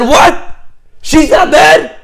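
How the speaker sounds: very high, loud disbelief; acted astonishment. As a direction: exclaim it as an amazed, thrilled mother. Mother's astonished reaction retold.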